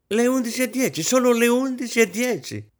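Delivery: very high, desperate wail. A narrator's reading. Hostess watching the clock, desperate. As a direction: wail it in despair. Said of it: "ripeté disperata".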